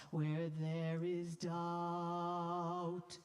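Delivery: Where there is doubt slow, ah held long in doubt; extended first vowel. Shown as a demonstration. Conductor modelling the extended vowel of doubt. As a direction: stretch the ah of doubt, glide late. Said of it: "you extend the first vowel".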